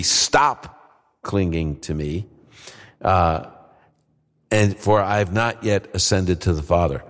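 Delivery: slow, measured, stress on clinging; slow emphatic reading. Shown as a demonstration. Host corrects the translation for the audience, slow and deliberate. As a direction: read the verse slowly so each word lands. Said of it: "how the C S B translates it".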